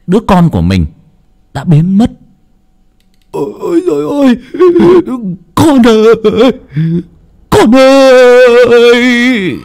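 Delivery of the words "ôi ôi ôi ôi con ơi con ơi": high, loud, drawn-out cry of despair; anguished wail. Acted. Father discovering his child gone, wailing in anguish. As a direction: wail for the lost child, stretching the last words.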